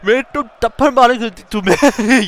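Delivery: mock a parent's scolding in Urdu, breaking into laughter; dad giving out. Dad telling off his raging son, laughingly imitated scolding. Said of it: "he got so mad that his dad came in and was just giving out".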